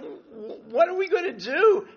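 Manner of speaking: stammered start, then high loud plea; desperate. Retold or imitated. Turning to Bob as the mother runs over, desperate. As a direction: act out panic, pitch shooting up. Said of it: "and I'm desperate".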